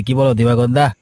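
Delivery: ordinary talk, nothing marked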